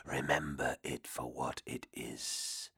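low, breathy whispering demon. Acted. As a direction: hiss it like a hidden demon. Demon speaks.

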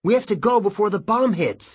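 high urgent panic; pleading to flee; panicked. Acted. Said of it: "panic rising in my throat".

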